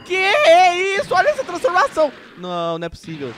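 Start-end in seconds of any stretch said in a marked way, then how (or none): 0.0-2.1 amazed shout. Real, not acted. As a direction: gasp it out in awe. Reagindo à transformação. (espanto alto e lento)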